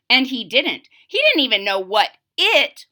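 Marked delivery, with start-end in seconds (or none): none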